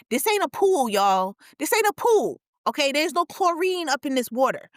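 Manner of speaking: high, loud exasperated rant; this ain't a pool, y'all; indignant outburst. Real, not acted. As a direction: yell it at the audience in disbelief.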